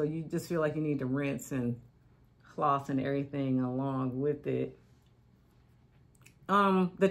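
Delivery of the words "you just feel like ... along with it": talking while brushing teeth; words around a stick; stick in mouth. Real, not acted. Seen 4: Rubs a stick against her teeth throughout the labelled words.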